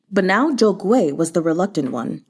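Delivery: ordinary delivery, nothing marked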